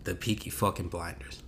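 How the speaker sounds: hushed whisper of the show name. Pretend. Echoing the show in his head, hushed whisper. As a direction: breathe it like a secret.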